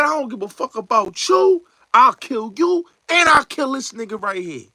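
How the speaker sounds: high-pitched, wild threat; menacing character quote. Retold or imitated. Retelling [name]'s threat in Juice, high wild menace.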